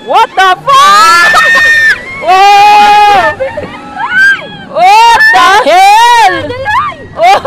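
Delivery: drawn-out, high screamed yell; long shrieked outburst; real ride scream. Real, not acted.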